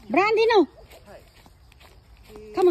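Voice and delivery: loud, high warning to a dog; calling off the dog. Real, not acted. Scolding her dog off something.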